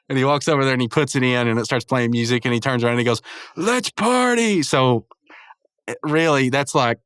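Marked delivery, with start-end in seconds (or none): 3.5-4.6 high, slow, drawn-out party call; mock party cheer. Muscle Man starts the music, imitated cheering. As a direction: stretch it out like a cartoon party starter.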